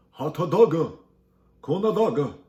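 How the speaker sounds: loud, high, hammed-up Korean accent; big put-on Korean accent with added vowels; mock Korean accent. Shown as a demonstration. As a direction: shout it in an exaggerated Korean accent. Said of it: "you say it with Korean accent".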